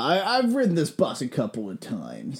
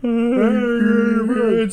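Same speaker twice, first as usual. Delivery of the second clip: slow, high echo of "Angry Birds"; 8 semitones higher, 6 dB louder, much slower than usual. Acted. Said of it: "let me hear Angry Birds".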